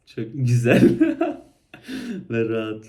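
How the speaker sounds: loud, words through laughter; laughing. Real, not acted. Laughing about weight gain. (gülerek söylüyor)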